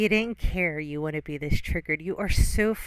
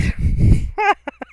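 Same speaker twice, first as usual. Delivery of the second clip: bursting into a high, loud laugh; sudden laugh; 9 dB louder than usual. Real, not acted. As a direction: breaks into a loud laugh mid-sentence. Laughs mid rant.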